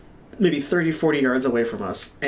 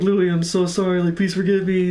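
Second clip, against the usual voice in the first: higher-pitched mock pleading; retold frantic apology; 6 semitones higher than usual. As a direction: act out begging a friend's forgiveness. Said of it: "I was laughing so hard".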